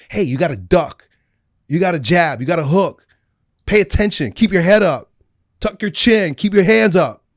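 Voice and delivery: loud coach barking drills; coaching call. Retold or imitated. God as a fight coach shouting drills to his son.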